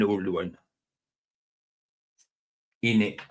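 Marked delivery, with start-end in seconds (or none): 2.8-3.2 short, punchy catchphrase "innit"; lightly imitated character catchphrase; Ali G. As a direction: throw out a comic street-slang "innit". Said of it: "Sound like Ali G in the house".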